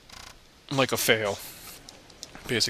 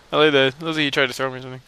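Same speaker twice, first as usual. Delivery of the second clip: ordinary delivery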